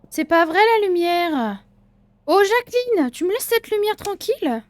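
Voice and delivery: high, loud scolding of a ghost; exasperated scolding. Real, not acted. Shop owner scolding the ghost Jacqueline, loud and high. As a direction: yell at the ghost to stop.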